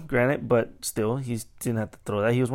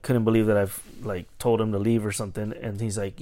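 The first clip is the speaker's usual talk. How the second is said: usual speaking voice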